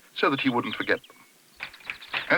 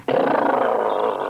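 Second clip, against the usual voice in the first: low, rolled shudder of cold; loud shivering brrr; 13 semitones lower, 4 dB louder than usual. A narrator's reading. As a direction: trill the lips in a deep, loud shiver. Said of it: "was a loud".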